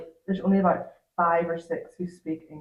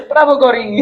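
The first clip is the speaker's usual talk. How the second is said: laughing while praising; laughing praise; 6 semitones higher, 9 dB louder than usual. Real, not acted. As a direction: say it through a laugh. Praises colleague in Greek, laughing.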